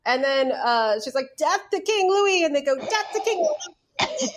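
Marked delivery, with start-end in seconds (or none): 1.4-2.4 high-pitched imitation of a rallying cry; mock revolutionary chant; mimicked film line. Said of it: "which is exactly how she says it". 2.8-4.3 high, slow mock chant; stretched, high-pitched mock crowd response; imitating the actress. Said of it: "which is exactly how she says it".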